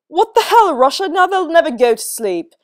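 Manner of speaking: angry shout. A narrator's reading. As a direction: blow up at Russia. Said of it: "shouted Arthur".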